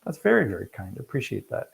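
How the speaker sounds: genuinely touched, pitch swooping up then softening; touched thanks. Real, not acted. Touched reply to a kind comment.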